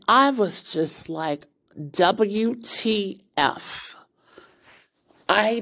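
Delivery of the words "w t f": spelled out letter by letter, very slowly; slow disbelief. Retold or imitated. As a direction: drag out each letter in stunned disbelief. Stunned reaction to a cruel prank.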